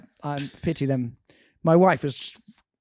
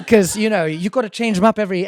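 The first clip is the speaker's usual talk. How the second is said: normal talking voice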